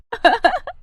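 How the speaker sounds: put-on high-pitched girlish laughter; teasing giggle. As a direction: giggle at a friend's expense. Friends tease about the wedding.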